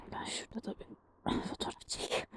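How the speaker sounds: whispered aside to herself; whispering. Real, not acted. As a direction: whisper it under your breath. Quiet aside while photographing.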